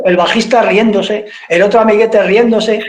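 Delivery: ordinary delivery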